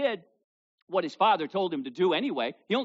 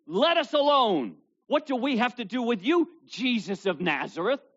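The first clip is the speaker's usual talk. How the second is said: loud demon cry; 9 semitones higher, 6 dB louder than usual. Retold or imitated. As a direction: cry it out like a possessed man. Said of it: "cried out of this man with a loud voice".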